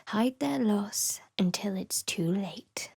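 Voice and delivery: slow secretive whisper. Acted. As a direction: hiss it softly like a spell. Spell incantation.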